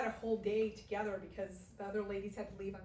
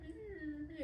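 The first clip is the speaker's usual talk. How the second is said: whiny daughter; 7 semitones higher, 7 dB quieter, much faster than usual. Retold or imitated. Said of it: "she goes".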